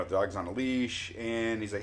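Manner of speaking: ordinary talk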